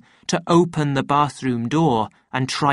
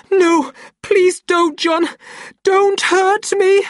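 very high, loud, slow wail; terrified pleading; 6 dB louder, slower than usual. A narrator's reading. Lenina cowering under his threat, high terrified plea. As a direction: beg for mercy in panic.

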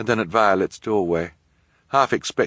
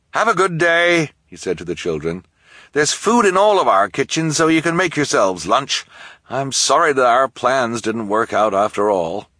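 apologetic host voice; 7 semitones higher, 5 dB louder than usual. Acted. Jerome apologetic farewell.

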